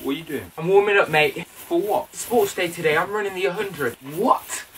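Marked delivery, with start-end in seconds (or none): none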